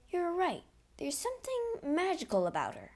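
high-pitched musing manager; manager character voice. A narrator's reading. Manager's line voiced in story.

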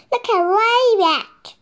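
high, loud delighted shout. A narrator's reading. Monkey cheering Rabbit on the skateboard, loud happy shout. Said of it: "shouted Monkey".

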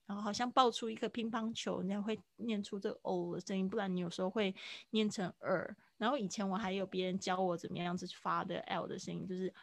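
usual speaking voice

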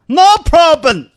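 very high, loud, slow English; proud promise. Acted. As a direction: beat your chest and boast it. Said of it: "男朋友拍拍胸脯答应说".

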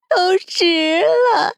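shrieking manic cackle round slow words; high-pitched crazed laughing. Acted. Captor sunk in madness, shrill unrestrained laughter. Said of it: "毫无形象可言的尖声笑道".

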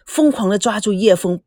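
usual speaking voice

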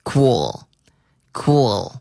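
cool whispered, tongue tip pressed on the upper teeth for the L; unvoiced demo of cool with the L held lightly. Shown as a demonstration. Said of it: "把舌头顶着上齿音".

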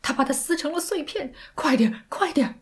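high, urgent cry; still shouting. Acted. Sandy urging the operator to hurry, still shouting. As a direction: keep shouting, deaf to the sarcasm. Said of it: "还在喊".

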